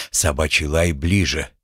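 ordinary delivery, nothing marked

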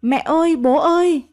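narrated cry for help, pitched high; high, frightened shout, kept slightly contained; panicked scream. A narrator's reading. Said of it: "Tôi hoảng hồn hét ầm lên".

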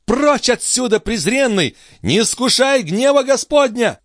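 high, furious banishment; enraged high-pitched command; convulsive fury. A narrator's reading. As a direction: drive him away in rage. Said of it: "с судорожной яростью вскричала девушка".

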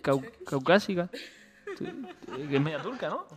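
chuckling through the words; laughing. Real, not acted. Host laughing while explaining the Kurdish woman's DNA, laughing. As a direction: laugh while you correct yourself. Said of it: "laughs while explaining".

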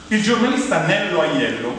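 ordinary talk

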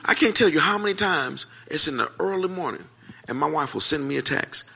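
usual speaking voice

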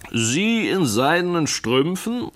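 high, drawn-out teasing question; slow, high-pitched jesting disbelief; joking exclamation. A narrator's reading. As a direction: tease a friend in mock astonishment. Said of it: "rief Mister Tupman scherzend aus".

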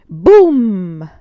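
a booming blast, shouted out; loud boom. A narrator's reading. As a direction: burst out with the cannon's boom. Narrator voices the explosion.

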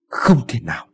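tearful, words breaking with a sob; husband weeps over his dead wife, crying. A narrator's reading. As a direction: say it as grief overtakes him.

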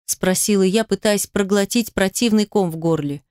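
plain reading voice

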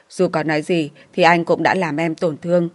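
usual speaking voice

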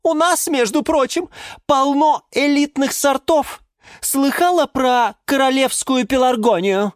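very high-pitched offended boast; squealing indignation. A narrator's reading. Geranium boasts of elite relatives, squealing indignantly. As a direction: brag back in a piercing high voice. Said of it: "завизжала в ответ герань Антонина".

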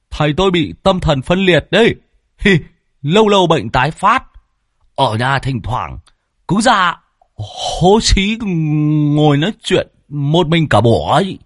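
high, teasing voice with a chuckle; mocking disciple. Disciple Sẹo making fun of his master to the villagers, mocking. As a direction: crow it high, stretching words for ridicule.

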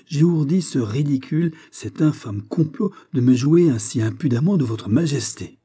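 wounded, emphatic denial; pleading indignation; indignant protest. A narrator's reading. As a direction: protest the accusation with affronted dignity. Accused young woman protests to king.

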